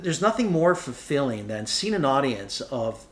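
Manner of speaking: ordinary talk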